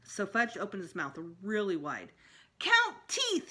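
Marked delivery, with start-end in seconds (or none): 2.6-3.5 put-on high, loud little-kid demand; toddler voice. A narrator's reading. Fudge demanding the dentist count his teeth in a high toddler voice. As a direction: chirp it like an eager toddler.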